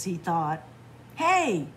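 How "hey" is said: high, loud call across a yard; hollering. A narrator's reading. Fox calls to the dancing animals, hollering. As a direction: holler to get their attention. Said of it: "he hollered".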